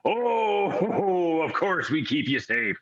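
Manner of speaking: high, amused crew voice with a laugh; the ship's crew teasing kindly. Acted. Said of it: "and they go".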